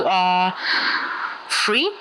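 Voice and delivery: normal talking voice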